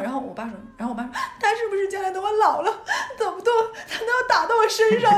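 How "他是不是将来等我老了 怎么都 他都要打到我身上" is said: high, loud voice breaking into laughter; laughing mock alarm. Dad fretting about being beaten in old age, laughing mock alarm. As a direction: crack up while imitating a worried parent.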